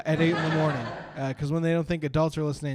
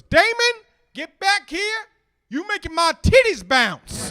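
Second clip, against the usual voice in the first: much higher, louder calling mom; mother calling her kid; 8 dB louder, slower than usual. Retold or imitated. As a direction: holler after a runaway kid. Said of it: "this is how she calls him back".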